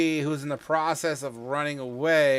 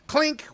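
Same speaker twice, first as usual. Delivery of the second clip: high, sharp metallic clink; bright sound-effect clink; 7 dB louder than usual. Retold or imitated. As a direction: mouth the ring of metal on metal. Sound of a blade hitting a lock.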